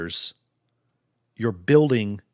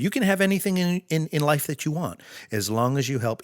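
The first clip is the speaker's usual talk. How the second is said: a light Southern US drawl, faster and higher; 5 semitones higher than usual. Retold or imitated. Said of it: "he said it in his southern drawl".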